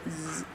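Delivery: very soft drowsy snore; mock snore. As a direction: drift off mid-book. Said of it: "quiet times reading".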